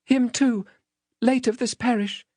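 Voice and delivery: put-on small, high-pitched child's answer; frightened child. Child answering the threatening man, timid. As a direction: answer meekly in a high little voice.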